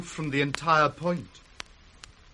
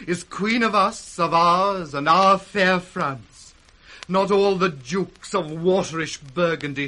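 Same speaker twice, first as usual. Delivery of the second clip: proud declaration; 4 semitones higher, 8 dB louder than usual. Acted. France claims Cordelia proudly.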